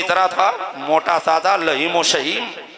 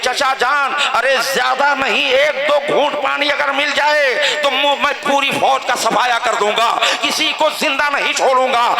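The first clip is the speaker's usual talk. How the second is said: raised, high-pitched pulpit declamation; impassioned preaching; 6 semitones higher than usual. Acted. Preacher voices nephew and uncle before the last battle, impassioned preaching. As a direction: carry the dialogue in a ringing preacher's pitch. Preaches the dialogue at full force.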